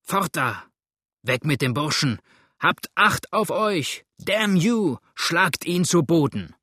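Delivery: building to high, loud shouting; raging crowd shouts. A narrator's reading. Mob closing in from all sides, building angry shouts. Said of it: "tobte es indessen von allen Seiten".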